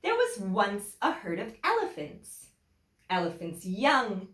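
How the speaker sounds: lively storybook reading with big swings; animated story voice for young listeners; reading to children. A narrator's reading. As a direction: read it to the class like a picture book. Teacher reads picture book aloud.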